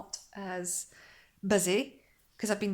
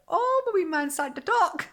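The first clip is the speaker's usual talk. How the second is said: much higher, louder delighted cry; excited gush; 11 semitones higher, 6 dB louder than usual. Retold or imitated. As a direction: gush like a thrilled mum. Proud mum quoting herself.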